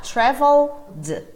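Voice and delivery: traveled split slowly and clearly into syllables, tra-veld; drawn-out syllabified traveled; syllables separated. Shown as a demonstration. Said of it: "Se eu tivesse que separar".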